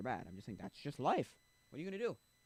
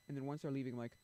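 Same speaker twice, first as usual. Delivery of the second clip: ordinary delivery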